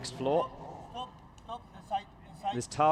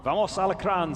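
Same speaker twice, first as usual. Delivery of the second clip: loud, higher rallying cry read out; fan cheer; 6 semitones higher, 6 dB louder than usual. Retold or imitated. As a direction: shout it like a fan in the stands. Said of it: "says Samuel Cortez".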